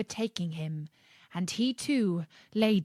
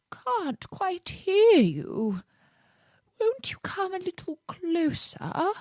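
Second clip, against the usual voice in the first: feeble voice; 11 semitones higher than usual. A narrator's reading. Sly fox faking frailty to lure the cookie. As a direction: fake weakness to lure him in. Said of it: "said the fox in a feeble voice".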